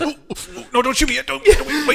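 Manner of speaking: panicked plea. Retold or imitated. Acted plea to hooker.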